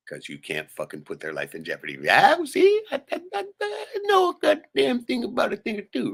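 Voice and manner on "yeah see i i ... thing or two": very high, loud squeaky voice; squeaky boast. Pretend. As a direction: brag in a shrill cartoon voice. Boasting in a put-on voice.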